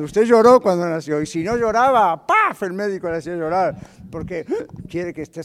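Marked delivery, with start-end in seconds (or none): 2.3-2.6 slap sound. Retold or imitated. Doctor slaps newborn.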